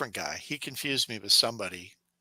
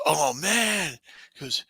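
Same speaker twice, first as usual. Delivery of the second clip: mock excited salesman; 8 semitones higher, 6 dB louder, slower than usual. Salesman voiced, excited at sniffing.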